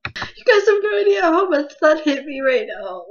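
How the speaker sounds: crying. Real, not acted. Telling viewers how the chapter hit, crying. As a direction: let the real tears come through. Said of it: "I'm tearing up".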